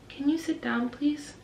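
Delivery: slow, careful preschool-teacher coaxing; calm teacher. Retold or imitated. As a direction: talk a small child down gently and patiently. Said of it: "me and my co-teacher are just so calm".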